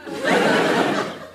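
embarrassed high titter; awkward high cackle. Acted. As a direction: laugh it off nervously. Witch laughs off mistake.

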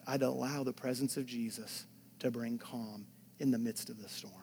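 usual speaking voice

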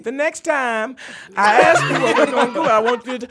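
high, loud, breathless mock mama through laughter; Loretta Devine out of breath. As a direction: gasp through the line like a winded TV mom. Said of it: "she'd be like".

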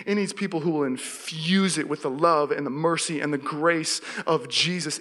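normal talking voice

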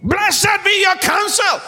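high, slow, shouted blessing; David's proclamation. Acted. Preacher voices David praising Abigail.

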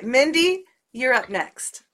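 ordinary delivery

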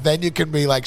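ordinary talk

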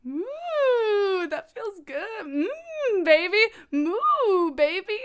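hammed-up breathy bedroom voice; mock sultry moaning. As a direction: ham up a mooing seduction. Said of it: "that'll get the guy going".

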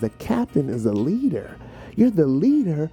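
ordinary talk, nothing marked

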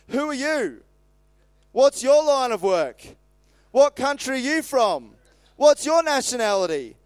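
high, loud interrogation with falling endings; demanding questions. A narrator's reading. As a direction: fire off each question as a demand. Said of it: "And they demanded".

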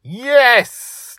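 very high, loud cheer; thrilled child's shout; whoop. A narrator's reading. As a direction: whoop it out in delight. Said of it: "Dragon Little whooped".

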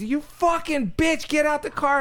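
high-pitched mock scream, laughing through it; screaming voicemail. Retelling the voicemail to friends, mock furious screech. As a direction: shriek it like the angry driver on the voicemail. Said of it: "and it said".

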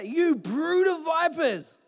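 high, slow, weighty denunciation; brand them a brood of vipers; denouncing preacher. Retold or imitated. Acting John denouncing Pharisees.

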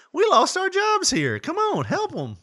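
hammed-up high desperate appeal; mock pleading worker. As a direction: beg in an exaggerated high voice. Acts out pleading Sears worker.

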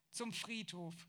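ordinary delivery, nothing marked

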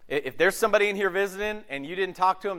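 ordinary delivery

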